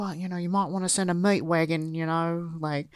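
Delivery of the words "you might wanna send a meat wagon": put-on Australian vowels; Australian accent. Podcaster showing how the other characters sound, mock Australian accent. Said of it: "They sound so".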